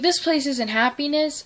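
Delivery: a touch of Leah's defiance; quoting Leah. Retold or imitated. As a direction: toss it off with scorn. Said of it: "Leah was like".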